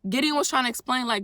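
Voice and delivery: ordinary talk, nothing marked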